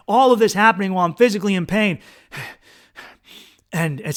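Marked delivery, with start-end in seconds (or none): none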